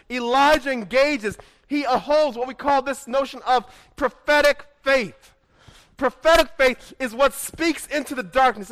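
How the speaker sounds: rising pulpit fervour; full-force preaching. Acted. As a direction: preach it out to the whole congregation.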